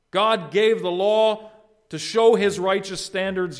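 loud, emphatic pulpit voice; high and hard-hitting at first, easing, then building back; forceful preaching. Real, not acted. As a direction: proclaim it with full projection.

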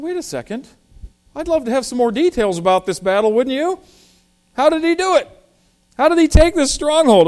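eager questioning. Acted. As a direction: act out a curious reader pressing for details, pitch jumping up. Acting out a curious reader's questions.

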